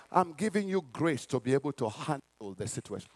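low, soft, measured answer; God. Acted. God answers a praying father, quietly low. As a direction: drop into a quiet, deep divine reply. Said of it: "you are saying".

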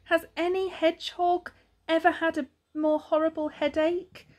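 high-pitched self-pitying voice; suffering young hedgehog.

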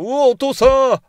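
sudden loud, high yelp at the sight of dad; startled cry. Real, not acted. Player spotting the creepy father, startled yelp. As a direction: jump at the shock.

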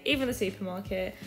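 usual speaking voice

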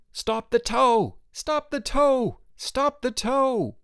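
very high, drawn-out calling cry; crowd shouting. A narrator's reading. Everyone on the hill calling to stop the tow, shouting. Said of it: "everyone else on the hill began to shout".

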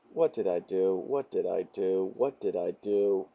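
low, soft repeated lament; quiet regret. Acted. Reader regretting the joke just made, quiet lament. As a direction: say it low and small, to yourself. Mock regret after reading meme.

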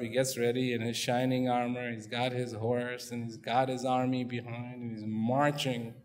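normal talking voice

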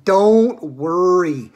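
very drawn-out, loud soothing; slow reassurance. Acted. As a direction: stretch the words out to soothe her. Wonka soothing the screaming mother.

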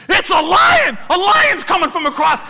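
high, loud cry of discovery; thrilled storyteller's yell; excited shout. Retold or imitated. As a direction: shout the sighting with stretched-out drama. Acts out spotting the lion.

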